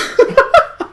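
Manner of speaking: high, wheezy burst of laughter; breathless high giggle; laughing. Real, not acted. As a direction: laugh hard at your own ending. Laughs after rolling the credits.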